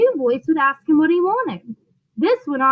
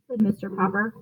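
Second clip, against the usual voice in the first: ordinary delivery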